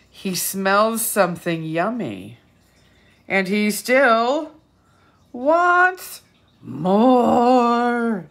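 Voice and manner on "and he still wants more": slow, much louder, drawn out; big final refrain. Shown as a demonstration. Reading the last refrain to children, slow and loud.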